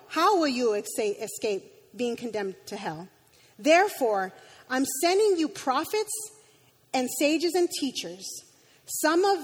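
reading Jesus' rebuke with rising heat; preacher voicing Jesus' anger at the leaders; angry scripture reading. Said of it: "the two that stuck with me was the anger".

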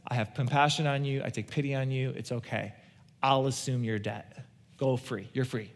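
hushed, merciful king's voice; gentle king. Retold or imitated. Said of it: "And the king says".

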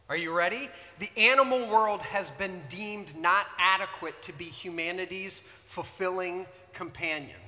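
ordinary talk, nothing marked

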